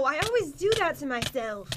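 normal talking voice

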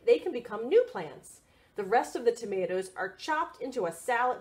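lively picture-book read; reading to children. Acted. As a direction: read it out brightly for little ones.

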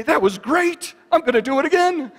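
over-the-top enthusiastic Tigger shout; excited Tigger. Acted. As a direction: burst out like Tigger loving the fall. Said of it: "He has got the excitement and the enthusiasm".

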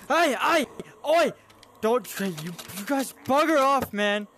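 loud, high frantic shouting; real frantic yell defending his base; panicked yelling. Real, not acted. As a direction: scream at the zombies to leave. Zombies break into his base.

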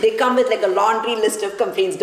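normal talking voice

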